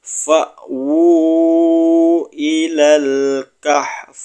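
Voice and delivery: slow chanted Arabic; Yu'jibuk recited slowly, final kaf breathed out; Quran recitation. Shown as a demonstration. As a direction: intone it like a tajwid teacher. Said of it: "Kita baca bersama-sama".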